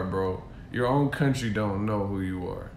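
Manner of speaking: normal talking voice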